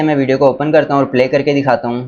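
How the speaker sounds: normal talking voice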